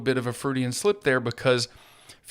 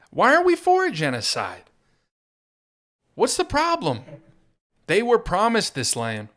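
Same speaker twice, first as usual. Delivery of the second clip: mock outrage; 10 semitones higher, 4 dB louder than usual.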